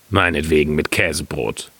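low, put-on husband voice; genügsamer Müller. A narrator's reading. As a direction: concede in the miller's deep voice. Miller answers wife in low voice. (genügsamer Müller mit tiefer Stimme)

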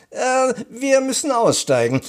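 high-pitched, urgent announcement; announcing. A narrator's reading. Aunt tells everyone to get off the tram, announcing. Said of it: "verkündete Tante Mathilda".

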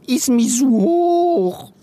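high, drawn-out creature whine; wailing. A narrator's reading. Chameleon Silly looking down from the desk, high wail. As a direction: whine it high, stretching the last word. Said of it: "jammerte sie".

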